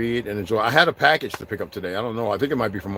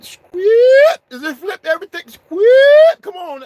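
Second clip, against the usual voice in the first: falsetto squeal; 11 dB louder, much slower than usual. Acted. Squealing about the dance.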